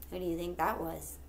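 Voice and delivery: ordinary delivery, nothing marked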